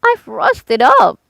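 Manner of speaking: slow, high creaky metal-man voice; stiff Tin Man. A narrator's reading. Rusted Tin Man complains to Dorothy, slow and stiff. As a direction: speak as if your jaw is rusted stiff.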